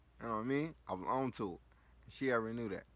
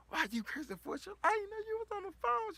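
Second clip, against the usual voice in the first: much higher, excited mother's voice; mom's voice; 4 dB louder than usual. Retold or imitated. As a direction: play an excited mom on the phone.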